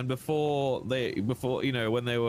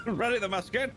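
high, silly officer's call; hammed-up high military call; mock drill command; 9 semitones higher than usual. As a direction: sing out a pretend musket order.

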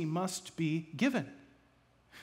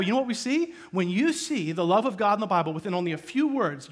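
ordinary talk

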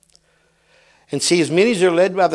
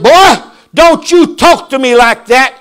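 louder, higher stern scold; daddy scolding; 6 semitones higher, 8 dB louder than usual. Retold or imitated. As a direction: scold the boy like his daddy. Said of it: "my daddy getting on to me".

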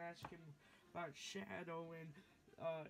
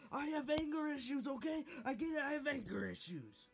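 loud and high, snapping back; angry shout; 10 semitones higher, 5 dB louder than usual. Acted. Snapping back at his friend about his anger issues, shouting. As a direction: yell it in defensive anger.

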